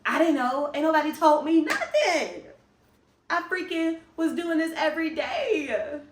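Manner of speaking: loud, high, worked-up complaint; exasperated outburst. Real, not acted. Vlogger vents to viewers about filming daily, loud and high.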